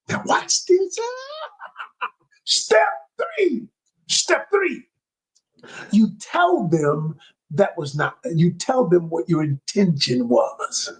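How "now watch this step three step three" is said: excited build-up. Real, not acted. Coach building to next step.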